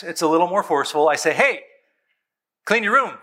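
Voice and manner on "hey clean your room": put-on higher, curt order to the kids; firm demand. Shown as a demonstration. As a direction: command it, no more asking. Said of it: "Now it's a demand".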